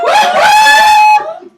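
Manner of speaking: high, loud woo-hoo; real delighted holler; loud cheer. Real, not acted. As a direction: cheer her in at full volume. Cheering the second guest's entrance.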